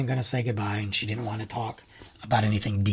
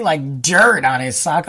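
ordinary talk

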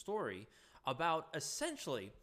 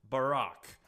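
put-on childish tantrum taunt; 6 dB louder, slower than usual. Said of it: "stamp his little feet all over the sheets and say".

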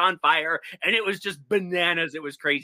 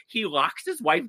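ordinary talk, nothing marked

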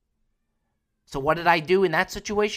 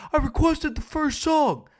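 much higher, loud hammed-up drunk boast; drunk friend; 12 semitones higher, 6 dB louder than usual. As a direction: squeal it like a proud wasted buddy. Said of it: "He goes".

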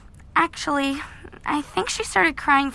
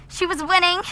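usual speaking voice

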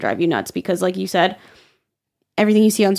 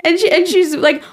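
ordinary talk

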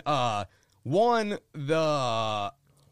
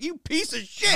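high disgusted yell; outraged retort; 13 semitones higher than usual. Retold or imitated. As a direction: spit it at him in disbelief. Retold outrage at dad.

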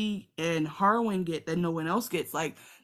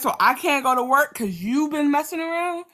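high, loud sarcastic comeback; hammed-up exasperation; mock indignant retort; 9 semitones higher, 9 dB louder than usual. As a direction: throw it back at her. Voicing Laenor's retort.

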